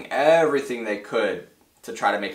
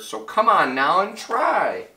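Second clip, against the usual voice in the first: higher, slow, pleading call; rally them to shout; urgent call; 9 semitones higher, 5 dB louder than usual. Acted. Urging the tiny people on.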